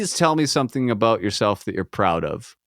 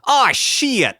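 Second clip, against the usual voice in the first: high, loud mock yelp of "Oh, shit"; a sudden pained squeal of "shit"; stub-toe swear; 12 semitones higher, 3 dB louder than usual. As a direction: yelp "Oh, shit" as if you just stubbed your toe. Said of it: "You're like".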